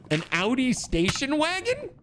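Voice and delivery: high disbelieving question; can't believe it, rising; incredulous. Real, not acted. Incredulous repeat of chat.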